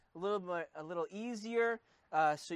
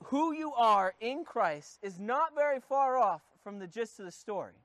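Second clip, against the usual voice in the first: ordinary talk, nothing marked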